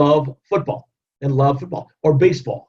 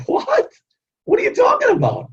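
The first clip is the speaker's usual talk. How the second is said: much higher, shouted disbelief; shocked disbelief. Retold or imitated. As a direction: blurt it out, stunned she credits you. Stunned at ex-colleague's thanks.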